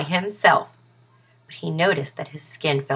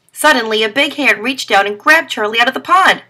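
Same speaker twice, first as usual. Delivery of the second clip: usual speaking voice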